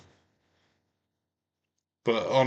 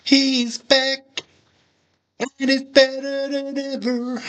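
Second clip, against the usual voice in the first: much higher, hyped wrestling promo boast; hammed-up promo voice. Fan imitates Bischoff's promo at the screen, high and hammed up. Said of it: "Bischoff promo imitation".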